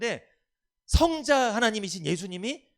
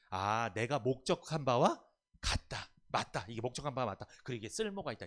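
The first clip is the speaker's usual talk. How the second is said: God's satisfied verdict; 5 semitones lower, 4 dB quieter than usual. Retold or imitated. Pastor voices God seeing the light, calmly pleased. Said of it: "하나님 딱 보니까".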